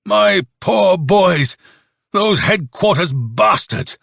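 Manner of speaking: high, slow, anguished shout; blazing shout. A narrator's reading. Colonel raging at headquarters for his boys, shouting. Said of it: "and shouted, his eyes blazing".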